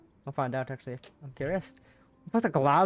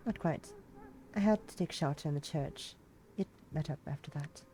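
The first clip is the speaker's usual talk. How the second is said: Ethel, quiet; 9 dB quieter than usual. Acted. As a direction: answer him softly, keeping it small.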